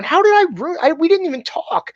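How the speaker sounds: high, laughing protest; squeaky amused protest, laughing; laughing disbelief. Retold or imitated. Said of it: "And I'm laughing, going".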